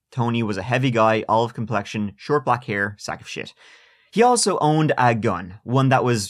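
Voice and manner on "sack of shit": hushed insult slipped in under the breath; whispered aside. Real, not acted. Host whispers an insult while describing the suspect. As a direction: whisper the jab to the listener.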